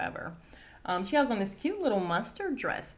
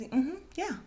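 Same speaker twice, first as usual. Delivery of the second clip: smug smile; 9 semitones higher than usual. Retold or imitated. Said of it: "Madam smiles and says".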